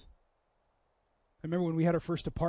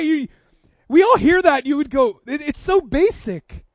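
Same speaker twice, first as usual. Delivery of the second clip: preaching emphasis; 8 semitones higher, 11 dB louder than usual. Real, not acted. Preacher driving home point.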